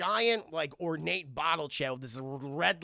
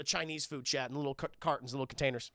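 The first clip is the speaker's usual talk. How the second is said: ordinary talk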